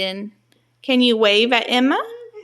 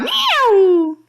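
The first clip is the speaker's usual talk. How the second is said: high, loud drawn-out meow; playful high-pitched cat imitation; 11 semitones higher, 5 dB louder than usual. Shown as a demonstration. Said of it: "what sound does a cat make".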